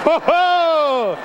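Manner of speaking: loud sudden exclamations; startled cry. Real, not acted. Preacher startled as volunteer nearly falls, loud. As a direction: react to someone wobbling.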